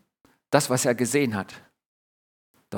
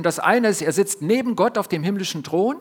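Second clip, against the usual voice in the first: ordinary talk, nothing marked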